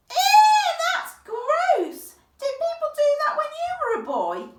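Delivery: high, loud giggly "ew"; laughing disgust. Acted. A girl grossed out by chamber pots, laughing loudly. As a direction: squeal with grossed-out delight.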